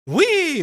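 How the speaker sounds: excited squeal. Shown as a demonstration. Host showing viewers her fire sign excitement, high squeal. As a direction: let out a playful squeal. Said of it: "I'm gonna do this 'cause I think it's funny".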